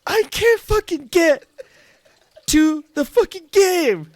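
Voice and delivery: high, slow frustrated whine. Retold or imitated. A dreamer stuck in the locker room, frustrated high whine. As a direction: whine it like a kid stuck off the ice.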